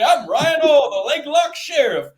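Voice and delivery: mock sheriff boast. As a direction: puff up as a cocky lawman. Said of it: "gets to walk around with his big ten-gallon hat and say".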